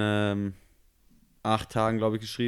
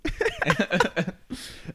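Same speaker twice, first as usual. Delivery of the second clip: kurzes, überraschtes Auflachen; Lachen; 7 semitones higher than usual. Real, not acted. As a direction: herauslachen über den Rülpser. Lacht über Rülpser des Partners. (lautes Auflachen)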